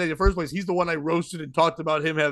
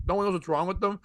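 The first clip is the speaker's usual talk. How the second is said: normal talking voice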